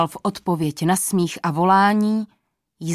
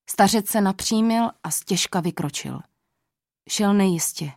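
usual speaking voice

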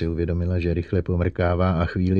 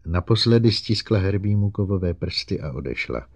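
ordinary delivery, nothing marked

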